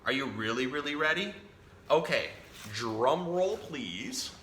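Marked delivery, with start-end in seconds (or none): none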